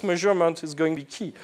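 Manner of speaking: ordinary talk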